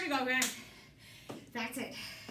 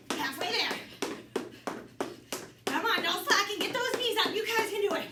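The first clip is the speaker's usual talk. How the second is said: aerobics instructor; 7 semitones higher than usual. Acted. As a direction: push the class hard. Coach drives high knees round.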